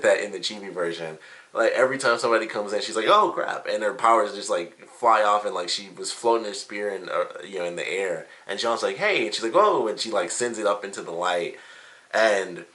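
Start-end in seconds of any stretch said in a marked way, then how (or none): 3.1-3.7 a high, sudden startled cry; jumpy startled yelp. Retold or imitated. Pyrrha startled when someone walks in, high yelp. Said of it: "apparently she's super jumpy". 9.0-9.3 cheery hey. Retold or imitated. Jean greets startled Pyrrha. 9.5-9.9 startled whoa. Retold or imitated. Pyrrha startled, spear flies.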